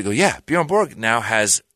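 ordinary talk, nothing marked

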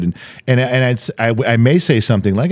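ordinary delivery

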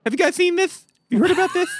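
pretending orange cones are breaking news; mock big reveal. Teasing podcast listeners about cones, in a mock amazed voice.

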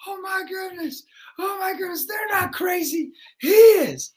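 high, mock-shocked gasp; shocked teachers. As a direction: panic at the speaker. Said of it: "the teachers are like".

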